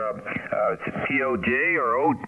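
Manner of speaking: normal talking voice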